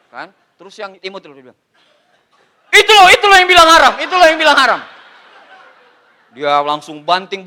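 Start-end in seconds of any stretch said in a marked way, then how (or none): 2.7-4.8 high, loud laughing mimicry of the MLM guy; mock whiny MLM guy. Comic mimicking the recruiter's squeaky accusation.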